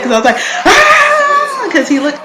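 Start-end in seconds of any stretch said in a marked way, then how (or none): 0.7-1.7 loud very high squeal; fangirl scream. Retold or imitated. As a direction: let out a starstruck shriek, re-enacted. Re-enacts scream at seeing [name].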